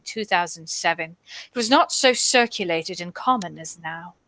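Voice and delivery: put-on lightly done British accent, reading the quote; a hint of a British accent, dramatic reading. Friends push her into reading it in a British accent. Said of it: "You're gonna make me do the British accent".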